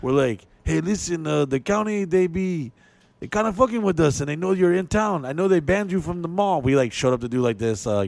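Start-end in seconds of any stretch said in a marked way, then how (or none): 0.6-6.6 higher, casual warning from a club boss; Mexican club owner. Retold or imitated. Club owner warning the comic about the county, pitched up. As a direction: lean in and tip him off, pitched up. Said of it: "Mexican, um, family".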